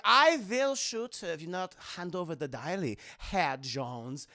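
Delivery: put-on measured villain with a German accent; Ernest Vogel. Colonel threatens over the diary, German accent. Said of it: "And Ernest Vogel's like".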